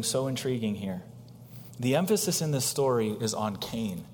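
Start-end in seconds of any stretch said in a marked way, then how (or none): none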